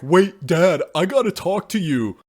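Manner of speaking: high, loud mock-alarmed daughter; daughter confronting dad. Daughter confronting her killer dad, in a high, loud alarmed voice. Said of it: "she's like".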